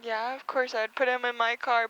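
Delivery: normal talking voice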